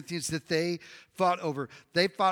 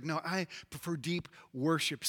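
low, soft, earnest counterclaim; hushed devout preference; 4 semitones lower, 8 dB quieter than usual. Acted. Preacher mimics worship lover answering, hushed and devout.

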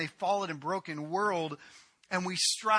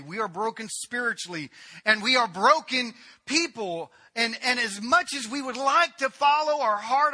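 loud, rising sermon delivery, higher and louder; fiery pulpit crescendo; full-force preaching; 6 semitones higher, 8 dB louder than usual. Acted. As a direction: preach it hard to the congregation.

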